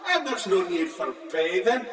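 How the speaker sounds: mocking Russian cosmonauts. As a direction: ham up the cosmonauts' excuse. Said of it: "for they say".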